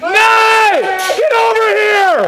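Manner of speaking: calling out. Real, not acted. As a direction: shout him over. Calling teammate in game.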